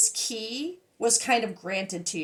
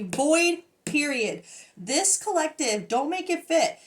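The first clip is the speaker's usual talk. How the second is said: usual speaking voice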